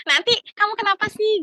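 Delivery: high, loud imitation of someone demanding an explanation; exclaimed questioning. Someone at home asking why nothing was said, exclaimed questioning. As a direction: blurt it as an imitated startled question. Imagined worried reaction to holding back.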